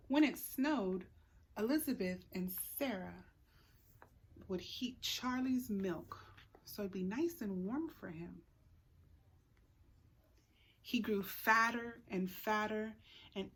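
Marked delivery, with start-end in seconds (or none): none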